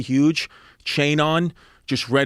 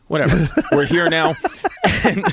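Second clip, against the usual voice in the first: louder, higher, breaking into laughter; laughing; 4 semitones higher, 4 dB louder than usual. Real, not acted. Teller moving on from dad's past, laughing. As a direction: shrug it off through a real laugh. Teller cracks up at own story.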